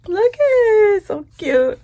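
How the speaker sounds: high, loud squeal of cuteness; delighted squeal. Real, not acted. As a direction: squeal it out, thrilled. Gushing over stickers.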